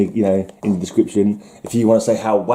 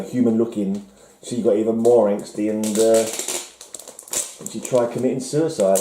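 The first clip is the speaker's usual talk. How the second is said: ordinary talk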